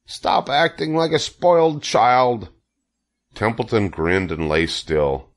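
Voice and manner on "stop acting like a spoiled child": high, slow scolding cry; exasperated scream. A narrator's reading. Wilbur scolds the lazy rat, exasperated scream. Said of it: "screamed Wilbur".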